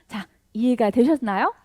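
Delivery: normal talking voice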